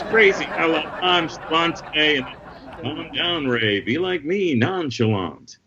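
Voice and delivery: joke character voice. Acted. Acting joke's character voice.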